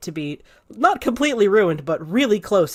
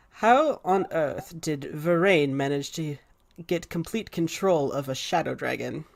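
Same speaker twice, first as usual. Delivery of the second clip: grave wizard; 3 semitones lower, 6 dB quieter than usual. Acted. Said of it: "he says to her".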